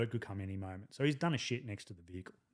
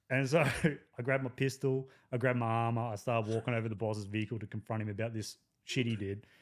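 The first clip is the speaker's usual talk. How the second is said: usual speaking voice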